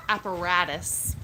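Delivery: American accent: say Apparatus with a d-like t. Shown as a demonstration. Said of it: "App-, apparatus".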